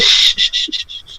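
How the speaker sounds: soft hushing shh; whispered shushing; mock hush. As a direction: hush them like a conspirator. Hushing in mock booker scene.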